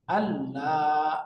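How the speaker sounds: আল্লাহ drawn out slowly, double l held; slow merged lam. Shown as a demonstration. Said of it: "এখন লিখবো আমরা". (ধীরে টেনে আল্লাহ)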